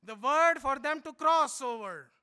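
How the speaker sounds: usual speaking voice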